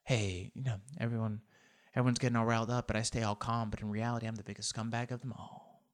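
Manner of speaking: breathy near-whisper, calm and sleazy; hushed Jeff Lowe impression. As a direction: whisper it like a smug scumbag who stays calm. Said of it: "Talks like this".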